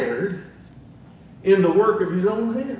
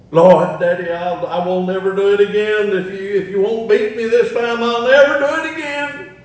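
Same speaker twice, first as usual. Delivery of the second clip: loud, rising mock plea to Daddy; hammed-up desperate pleading, climbing higher; begging; 4 semitones higher, 7 dB louder than usual. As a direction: imitate a kid begging out of a beating. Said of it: "All the begging".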